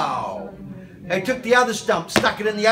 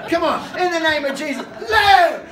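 loud high command of "Live!"; commanding shout; 6 semitones higher, 5 dB louder than usual. Retold or imitated. Preacher retelling a prayer, shouting the command. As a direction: bark it out like a healing command.

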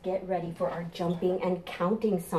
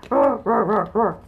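dinosaur roars; 4 semitones higher, 9 dB louder than usual. Pretend.

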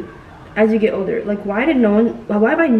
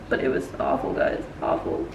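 hushed confession to the viewers; half-whispered awful; 8 dB quieter than usual. Real, not acted. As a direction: whisper it, much softer, as if still shaken. Telling viewers about the trauma.

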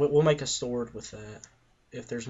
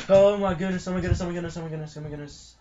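panicked; 7 semitones higher, 8 dB louder, much faster than usual. Real, not acted. Panic about someone entering room.

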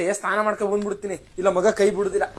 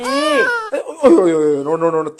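dramatic wail; 4 dB louder than usual. Acted. Baby wets again, she wails.

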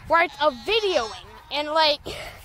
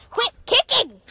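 loud high scold; 8 semitones higher, 5 dB louder than usual. Real, not acted. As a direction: tell it off sharply. Scolding the kicking guinea.

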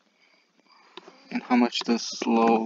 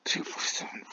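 whispered counting; 4 semitones higher, 11 dB quieter than usual. Real, not acted. Nervous counting under breath.

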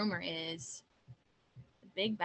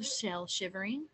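ordinary delivery, nothing marked

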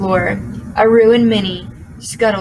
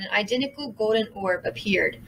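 ordinary delivery, nothing marked

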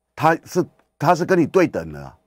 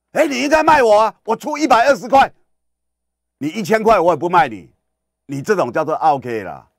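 much higher, louder insistent pleading; mock pleading customer; 7 semitones higher, 4 dB louder than usual. Customer pleads with the shopkeeper, high mock insistence. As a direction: play a shopper demanding the sale.

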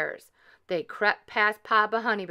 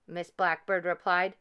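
plain reading voice

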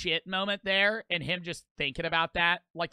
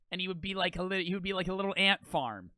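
normal talking voice

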